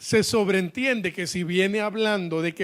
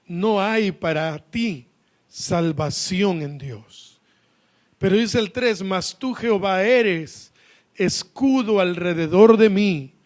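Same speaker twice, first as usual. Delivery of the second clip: loud, slow scripture reading; declaimed psalm; 4 dB louder than usual. Acted. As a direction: proclaim the psalm phrase by phrase to the congregation. Reads the psalm aloud. (salmo proclamado con fuerza y pausa)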